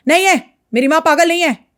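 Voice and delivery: half-hearted imitation of a yelling husband; retold yell. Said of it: "चिल्ला के बोलता".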